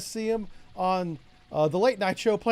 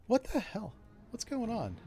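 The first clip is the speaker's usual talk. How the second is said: sudden puzzled "What's going on?" asked a bit higher; real surprise; 5 semitones higher, 8 dB quieter than usual. Real, not acted. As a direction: caught off guard, asking what's happening. Startled by missed shots in game.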